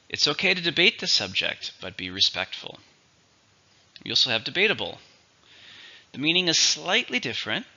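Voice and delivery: usual speaking voice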